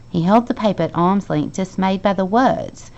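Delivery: ordinary delivery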